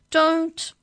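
high, loud cry of alarm; panicked cry. A narrator's reading. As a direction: cry out in panic to stop them. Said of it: "cried Maury".

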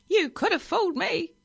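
high, loud, sarcastic squawk; parrot voice. A narrator's reading. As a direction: scoff as a shrieky bird. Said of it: "said the parrot".

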